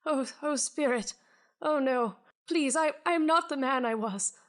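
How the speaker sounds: haunted man pleading with the spirit for another chance; pleading ghost. Acted. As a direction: beg the spirit for mercy, Dickens style. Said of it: "He says".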